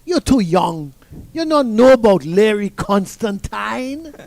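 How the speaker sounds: Jamaican coach scolding. Retold or imitated. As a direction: gently tell off the youngster in Jamaican. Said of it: "he said".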